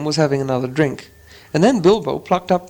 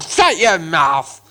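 slow, rasped-out troll retort after choking; troll snapping back after choking; choked troll retort; 3 semitones higher, much slower than usual. Acted. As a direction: force it out, still half choking. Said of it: "he said as soon as he could".

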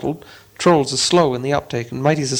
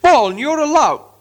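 much higher retort; angry retort; 12 semitones higher, 4 dB louder than usual. Acted. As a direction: throw the insult back. Trolls quarrelling.

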